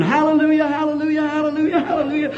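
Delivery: high, ecstatic hallelujah shouts; repeated joyous hallelujah shouts; screamed hallelujahs. Retold or imitated. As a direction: cry it out to the heavens.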